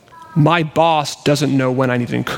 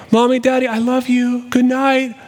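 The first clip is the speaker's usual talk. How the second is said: put-on high child's call to the parents; high, singsong bedtime wail; 10 semitones higher than usual. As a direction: imitate a little girl calling out from bed. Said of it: "we'll hear wailing across the span".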